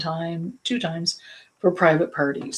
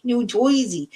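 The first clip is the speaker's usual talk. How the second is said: put-on higher, playful New Jersey accent, Joisey; 6 semitones higher than usual. Teasing Liz about her home state, mock New Jersey accent. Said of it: "talk like we're from Jersey".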